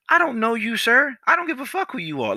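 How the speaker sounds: very high-pitched, worked-up dismissal; retold heated argument; indignant retort. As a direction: act out an exasperated comeback, voice way up. Acted angry reply to customer.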